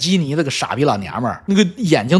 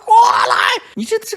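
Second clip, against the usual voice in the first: imitated yelling; faster than usual. Mother hollering for her child on the flight deck, imitated yelling. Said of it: "他在那个中途岛飞行甲板上喊啊".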